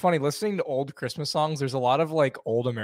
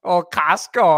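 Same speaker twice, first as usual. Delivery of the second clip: Minnesota accent; 2 semitones higher, 7 dB louder, slower than usual. Shown as a demonstration. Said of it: "where my girlfriend found my accent".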